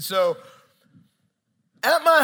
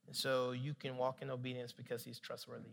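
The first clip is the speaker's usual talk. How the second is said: very soft, low voice; gentle questioning; 8 semitones lower, 15 dB quieter than usual. Retold or imitated. Holy Spirit urging Nick to obey, gentle and hushed. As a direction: lead him quietly to the answer.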